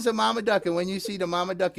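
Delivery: ordinary delivery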